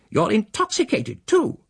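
indignant spinster. Acted. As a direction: play a shrill-tempered churchgoing woman, affronted. The female accuses him.

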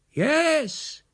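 high far-off answering call; faint distant voice. A narrator's reading. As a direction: answer from across a farmyard. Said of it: "we heard the faint voice far across the farmyard".

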